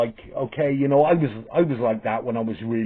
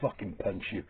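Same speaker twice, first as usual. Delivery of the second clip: inner thought; 5 semitones lower, 13 dB quieter than usual. Retold or imitated. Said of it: "and I just thought".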